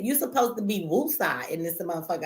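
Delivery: ordinary delivery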